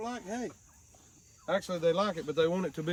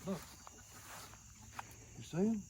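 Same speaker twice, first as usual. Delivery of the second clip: ordinary delivery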